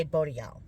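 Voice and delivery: "boat y'all", th swallowed into a t sound; Church Point pronunciation. Shown as a demonstration. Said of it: "I'd say".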